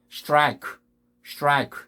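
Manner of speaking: 스트라이크 as one syllable "straik"; no vowels inside the str of 스트라이크; English pronunciation of strike. Shown as a demonstration. Teacher giving similar examples, English-style strike. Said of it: "이런 비슷한 발음들이".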